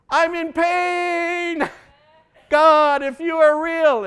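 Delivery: high, slow, strained cry to God; desperate plea. Pretend. A hurting believer begs God, high, slow and strained. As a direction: beg God as if at the end of your rope.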